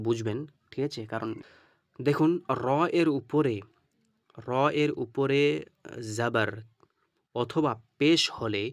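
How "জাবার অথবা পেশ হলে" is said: very slow, deliberate teaching phrase; slow emphasis. Shown as a demonstration. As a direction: spell out each word slowly to the class. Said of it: "অর্থাৎ পুর করে পড়তে হবে".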